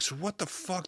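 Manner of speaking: baffled high murmur. Retold or imitated. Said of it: "So I'm like".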